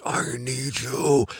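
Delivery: soft, slow, aching mock plea; mock longing plea. Woman urging her partner, soft and slow. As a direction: drag it out softly like she can't wait. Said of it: "and it's like".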